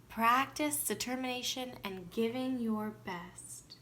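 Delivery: ordinary delivery, nothing marked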